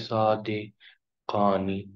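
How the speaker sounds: صادقانی drawn out slowly; slow teaching pronunciation; slow model word. Shown as a demonstration. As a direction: stretch صادقانی. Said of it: "یہ دونوں سچے ہیں".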